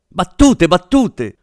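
high, urgent yell; director's shout. A narrator's reading. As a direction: yell a prompt at the actors. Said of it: "urlò Morin". (urlato dal regista)

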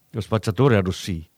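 ordinary delivery

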